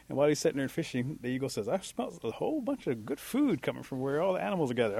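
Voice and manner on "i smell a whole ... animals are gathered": higher-pitched, animated account; curious reporting. Acted. Eagle to bear by the creek, curious higher-pitched report. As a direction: tell what you noticed, pitch held up.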